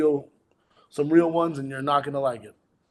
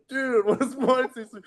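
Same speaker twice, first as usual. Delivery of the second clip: "Dude, what does my tattoo say?" pitched way up; high mock quote; 9 semitones higher than usual. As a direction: squeak the movie line up high. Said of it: "like, this, like".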